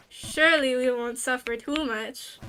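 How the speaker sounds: usual speaking voice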